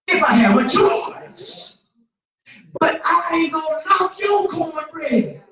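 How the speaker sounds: loud, high, fired-up preaching about cornbread; rising preacher's holler; full-force preaching. Acted. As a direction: preach it loud to the whole church.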